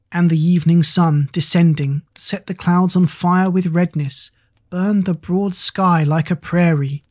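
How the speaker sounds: usual speaking voice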